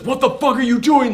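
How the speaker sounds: high-pitched, agitated outburst; mock outraged demand. Kidnapper messaging after losing his money, outraged. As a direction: act out an alarmed, angry demand.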